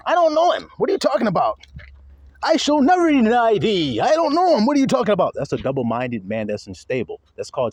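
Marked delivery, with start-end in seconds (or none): 0.1-1.6 higher, defensive denial; Peter denying. Retold or imitated. Preacher voicing Peter denying Jesus, defensive and high. 2.4-5.3 louder, higher defensive protest; Peter denying. Retold or imitated. Preacher replaying Peter's denial, louder and high.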